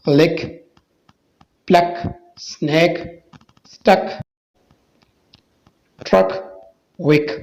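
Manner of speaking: word list with CK said as one k; CK as "kaf". Shown as a demonstration. Teacher drilling CK words for viewers, one k sound. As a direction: say each word alone, CK a single k sound. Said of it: "काफ का आवाज़ देता है".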